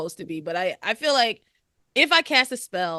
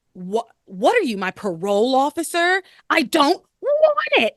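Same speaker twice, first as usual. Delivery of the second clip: nasty attitude; 7 semitones higher, 7 dB louder than usual. Retold or imitated. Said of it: "she has, like, a nasty attitude".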